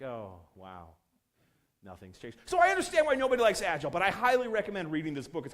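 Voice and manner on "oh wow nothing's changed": underwhelmed. Acted. Reaction voiced after a release.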